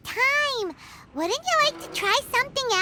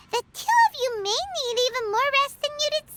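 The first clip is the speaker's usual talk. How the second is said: ordinary talk, nothing marked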